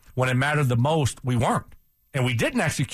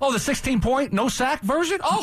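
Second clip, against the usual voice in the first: sarcastic; 11 semitones higher than usual. Real, not acted. Mocking co-host's optimism.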